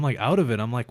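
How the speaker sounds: ordinary talk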